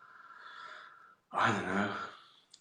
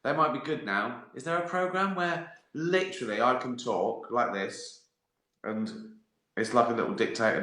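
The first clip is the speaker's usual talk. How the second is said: ordinary delivery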